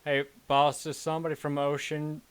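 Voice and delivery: loud radio call to boss; guard on radio. Acted. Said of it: "it's gonna".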